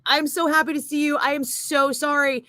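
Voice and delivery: lightly voiced overwhelmed friend, begging sorry; gushing apology. Retelling Keith on his knees in the lobby, mild gushing apology.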